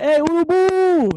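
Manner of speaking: loud, high, drawn-out holler; imitated hailing shout. People spotting him anywhere, shouted nickname greeting. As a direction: hail a friend with a stretched-out yell.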